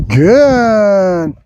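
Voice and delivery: long, stretched-out praise word; duration marker. Real, not acted. Trainer telling dog to keep waiting, drawn-out marker. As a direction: hold the word out slowly, letting it fall. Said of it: "accenting the good duration marker".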